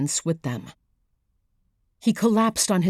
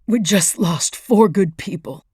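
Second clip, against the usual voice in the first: grief-choked, straining to speak; 2 semitones higher, 5 dB louder than usual. Acted. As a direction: voice tight and choked. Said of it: "said Sharp in a choked voice".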